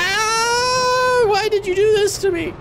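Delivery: very high, loud fake crying; mock wail. Player blames partner for the mishap, mock-crying wail. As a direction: wail it like a melodramatic victim.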